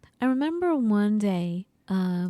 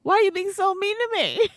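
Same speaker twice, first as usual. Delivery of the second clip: hammed-up hurt whine; mock hurt; 11 semitones higher than usual. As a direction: whine like a wounded child. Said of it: "I'm like".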